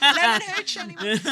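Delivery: high, loud hopeful call; mock cheerful offer; overkeen offer. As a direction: pitch it like a hopeful party host. Mock offering drinks to classmates.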